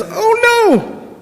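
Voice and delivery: squawked high, a cartoon hen's alarm; a hen flapping in panic; fussy chicken. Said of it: "and the chicken says".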